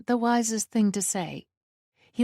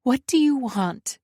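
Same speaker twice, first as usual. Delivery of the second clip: higher, tense demand; fear held back; 5 semitones higher, 3 dB louder than usual. A narrator's reading. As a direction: ask firmly, masking the fear. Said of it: "trying not to let my fear show".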